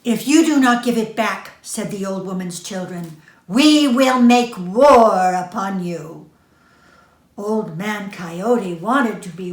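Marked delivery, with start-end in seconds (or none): 0.0-1.5 fast angry threat. Acted. Children threaten war. 3.5-6.3 slow, loud threat of war. Acted.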